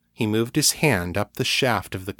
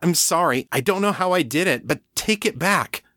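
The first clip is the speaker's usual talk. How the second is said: higher, fast desperate pleading; Kate, pleading; 9 semitones higher than usual. A narrator's reading. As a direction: rush the apology, beg.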